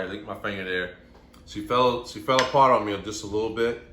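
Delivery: usual speaking voice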